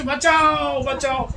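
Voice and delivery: बचाओ called high and loud, falling; loud cry for help. Shown as a demonstration. Preacher showing devotees the loud way to call, shouting. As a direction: shout बचाओ like a drowning man. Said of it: "हाँ, जोर से".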